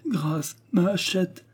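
put-on child pleading for his hatchet; pleading lament. A narrator's reading. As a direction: beg for mercy, near tears. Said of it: "remplit la combe de ses lamentations".

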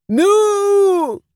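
very high, loud furious yowl; a cat stepped on in the dark; angry cat yowl. A narrator's reading. The cat yowls angrily when the husband trips over him. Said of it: "его гневный вопль".

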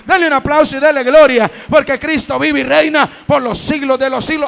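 loud, rising preacher's climax; preaching at full force. Acted. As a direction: drive it home to the congregation.

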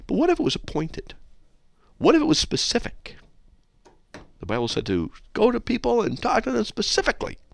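squeaky high hypothetical questioning; mock innocence. Host teasing a critic with what-ifs, mock-innocent. Playfully argues back at hate mail.

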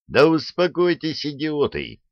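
high, exasperated rattle; hurried jabber. A narrator's reading. Petrovsky to the panicking crew, high hurried jabber. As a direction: rattle it off to calm them. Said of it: "затараторил Петровский".

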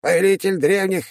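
high, very slow grand self-announcement; pompous demon. Acted. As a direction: proclaim your title like a tiny lord.

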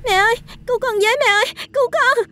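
high, fast, panicked cry for help; terrified scream. A narrator's reading. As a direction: shriek for mother in terror. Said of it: "nó gào lên thất thanh". (tiếng thét hoảng loạn gọi mẹ)

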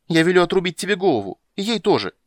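put-on high, loud childish threat; yelling. A narrator's reading. Princess threatens witcher and dryad, yelling in tantrum. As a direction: yell orders like a furious little princess. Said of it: "хватит вопить".

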